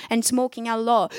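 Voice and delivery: ordinary talk, nothing marked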